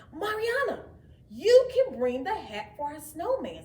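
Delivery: high, loud call to her sister; bright calling. A narrator's reading. Jenny calling Mariana to bring the hat, bright. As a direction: call her over eagerly. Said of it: "said Jenny".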